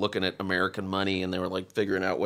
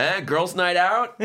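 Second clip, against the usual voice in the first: high, slow leering tease; leering Dave; 9 semitones higher, 4 dB louder, slower than usual. Retold or imitated. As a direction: smirk the question at the couple. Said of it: "he goes".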